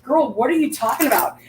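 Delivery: high, disbelieving reply to a friend; incredulous girl. Retold or imitated. Her own reply retold.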